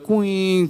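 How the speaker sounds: imitated feedback whine; drawn-out cooing squeal of a speaker; howling feedback sound. Shown as a demonstration. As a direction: mimic mic feedback howling. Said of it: "kind of a howling sound".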